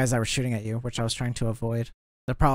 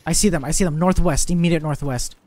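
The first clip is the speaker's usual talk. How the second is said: loud, high urgent callout; excited callout; 4 semitones higher, 5 dB louder than usual. Real, not acted. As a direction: shout the enemy position. Spotting enemy, urgent callout.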